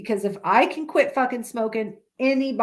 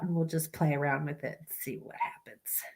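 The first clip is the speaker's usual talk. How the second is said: whispered aside; 7 semitones lower, 11 dB quieter than usual. Real, not acted. Trails into a whisper.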